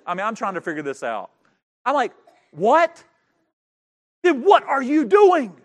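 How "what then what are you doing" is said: loud, high, incredulous demand; baffled outburst. Retold or imitated. Husband replays confronting his dancing wife, loudly baffled. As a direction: burst out in exaggerated bafflement.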